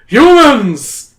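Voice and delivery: loud, high royal bellow; booming. A narrator's reading. Cave king summons the humans, booming. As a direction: boom it through a cavern. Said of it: "His voice booms through the cavern".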